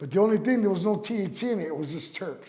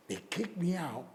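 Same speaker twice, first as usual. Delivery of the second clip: ordinary delivery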